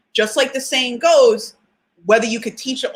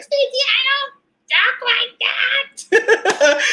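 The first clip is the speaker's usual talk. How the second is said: much higher, very slow, laughing protest; protesting mom; 13 semitones higher, slower than usual. Retold or imitated. Mom protests the impression of her, high and laughing. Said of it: "So my mom's here like".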